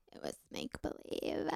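soft unvoiced whisper. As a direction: breathe it out in a whisper. Whispered first take.

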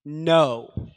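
a loud, final no; emphatic no. Shown as a demonstration. Preacher showing Jesus' answer, emphatic. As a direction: plant the word no like a wall. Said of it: "It is an absolute, emphatic".

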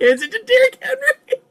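high, breaking into laughter; laughing. Real, not acted. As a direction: crack up while saying it. Cracking up at the meme.

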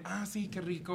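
soft, low fake moan; put-on pleasure. Showing friends her bedroom sounds, soft fake moaning. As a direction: feign enjoyment under your breath. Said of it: "sí hago sonidos así como de".

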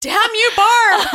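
high, loud, laughing outburst; laughing mock curse. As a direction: shout it through laughter.

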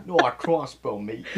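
lightly hammed British accent, loud; horrible British accent. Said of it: "a horrible British accent".

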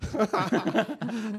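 high burst of laughter; laughing at being reminded. Real, not acted. Remembering the sauna fart.

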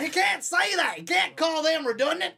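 high, loud Southern holler; Southern accent shout. Acted. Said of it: "got into the Southern accent".

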